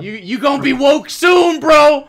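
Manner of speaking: loud, high-pitched mock warning, half laughing; mock threat. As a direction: yell it like a playful threat. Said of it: "really sounds like a threat".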